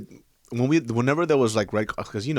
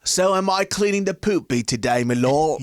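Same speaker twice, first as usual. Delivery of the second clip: higher-pitched mock British servant; hammed-up, badly faked British accent; 7 semitones higher, 3 dB louder than usual. Stable boy asking his lord about chores, bad British accent. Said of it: "fucking up that accent so bad".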